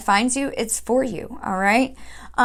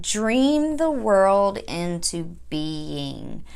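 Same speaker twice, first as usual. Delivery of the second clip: slow, solemn oracle reading; solemn card reading; 4 semitones lower, much slower than usual. Shown as a demonstration. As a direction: intone the card's words slowly. Reads the card's message aloud.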